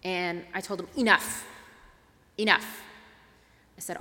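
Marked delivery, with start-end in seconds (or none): 1.0-2.8 firm enough. Retold or imitated. Firmly stopping the screaming man.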